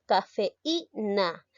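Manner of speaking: cafeína slowly, weight on the í; teacher stretching cafeína for students; slow demo word. Shown as a demonstration. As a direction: drag out cafeína syllable by syllable.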